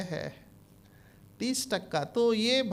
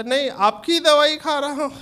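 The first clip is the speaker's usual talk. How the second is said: defensive patient; 6 semitones higher, 7 dB louder than usual. Retold or imitated. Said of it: "बोला".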